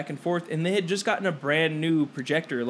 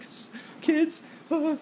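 high, quiet mock sob; retold crying; 11 semitones higher, 4 dB quieter, much slower than usual. Friend imitating the crying substitute teacher, mock tearful. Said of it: "literally crying".

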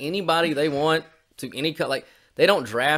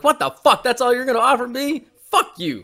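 very high, loud outburst; outraged shout; 11 semitones higher, 6 dB louder than usual. Acted. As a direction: explode at an insulting offer. Acting outraged player given low offer.

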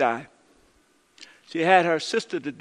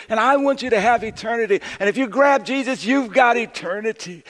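raised, fast, urgent preaching; preaching at full force; 5 semitones higher, 4 dB louder than usual. Acted. Pastor preaching his grandma's words to the congregation, full force. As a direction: drive the message home from the pulpit.

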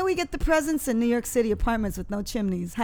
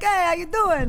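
put-on Brooklyn accent; 6 semitones higher, 4 dB louder, slower than usual. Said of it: "Brooklyn accent makes mine worse".

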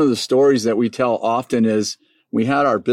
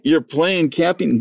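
incredulous; 6 semitones higher, slower than usual. Retold or imitated. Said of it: "I'm like".

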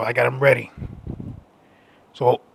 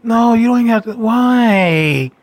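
higher, whiny begging to stay; mock clingy pleading; 6 semitones higher, much slower than usual. Clingy hookup begging him to stay, mock whine. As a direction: plead with a leaving guest, stretching "spend the night". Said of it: "I hate that when I'm over".

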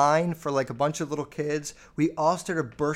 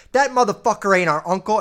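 loud, higher mock outburst; imitating angry mom; 6 semitones higher, 10 dB louder than usual. Mom suddenly snapping at dad over the uncle name, angry imitation. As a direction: burst out loud as an enraged mother. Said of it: "my mom gets in this mode when she's mad".

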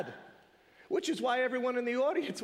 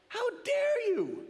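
slow, high, hammed-up indignation; mock outrage; 12 semitones higher, much slower than usual. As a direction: drag it out like a mock-offended son. Voicing the brother's outrage.

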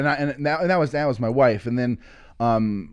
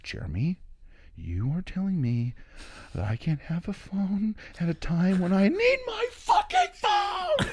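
soft to screaming; 8 semitones higher, 4 dB quieter than usual. Retold or imitated. Angry woman demanding her phone, soft building to screaming. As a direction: build from quiet anger to a squealed, held-back scream. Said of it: "it went from soft to, like, screaming".

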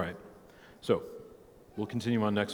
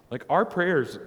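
usual speaking voice

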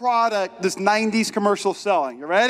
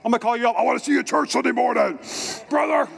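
a hint of a hyped-up wrestler promo. Pastor mimicking a nineties wrestler calling out a rival, lightly hammed. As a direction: growl it out like a TV wrestler cutting a promo.

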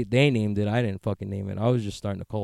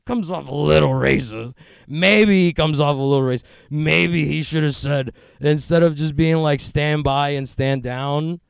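heated rant; 5 semitones higher, 7 dB louder than usual. Acted. Rant voicing imagined critics.

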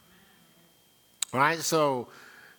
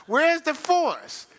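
high teasing call; 12 semitones higher, 4 dB louder, much slower than usual. Real, not acted. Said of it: "preacher teasing with exaggerated pitch".

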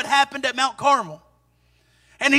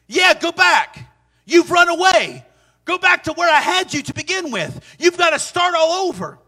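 emphatic command; 6 semitones higher, 4 dB louder than usual. Acted. Preacher voicing God's command to Elijah.

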